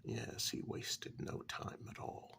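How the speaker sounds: plain reading voice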